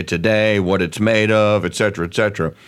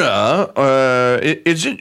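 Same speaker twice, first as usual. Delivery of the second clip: usual speaking voice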